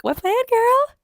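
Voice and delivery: loud, very high squawked question; mock incredulous outburst. Reader calls out the heroine's plan, mock incredulous. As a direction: shoot way up in hammed-up disbelief.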